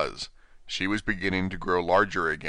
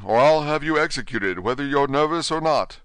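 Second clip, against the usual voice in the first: angry king; 7 semitones higher, 6 dB louder than usual. Acted. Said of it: "the king repeated angrily".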